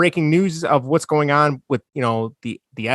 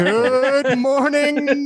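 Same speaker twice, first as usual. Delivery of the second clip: radio DJ call; 10 semitones higher, much slower than usual. Retold or imitated. Quoting the famous radio call.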